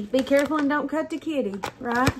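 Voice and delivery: normal talking voice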